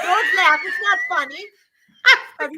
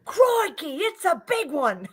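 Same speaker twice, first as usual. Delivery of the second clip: Steve Irwin; 2 semitones higher, slower than usual. Acted. Showing what she'd have to do, hammed-up Australian accent. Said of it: "Steve Irwin, yeah".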